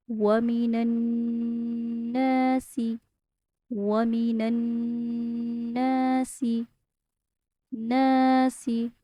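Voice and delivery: वमीनन नासी recited slowly with a held nasal; slow recitation. Shown as a demonstration. Teacher recites the whole phrase, slow tajweed recitation. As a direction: draw out नासी at the end. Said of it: "सीन के नीचे ज़ेर है".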